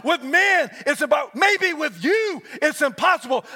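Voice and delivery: rising, shouted preacher cadence; preaching at full force. Acted. Preacher to congregation, building to a shout.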